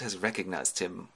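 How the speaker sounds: ordinary delivery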